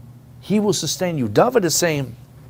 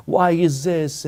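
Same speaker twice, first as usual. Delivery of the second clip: higher, drawn-out mock complaint; mock woe; 5 semitones higher, 4 dB louder, slower than usual. Preacher imitating people stuck in being broken, mock-woeful. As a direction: stretch it out like a self-pitying complainer. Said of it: "listen to their woes and all their troubles".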